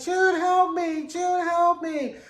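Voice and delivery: high, slow, pleading wail; imitated Serena begging June as her baby is taken; mock frantic plea. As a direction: imitate a desperate woman begging for help. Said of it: "she just starts screaming and screaming".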